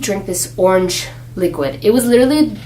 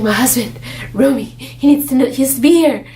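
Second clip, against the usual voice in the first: higher, pleading retold objection; arguing inner voice; 5 semitones higher than usual. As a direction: protest as the voice of conscience.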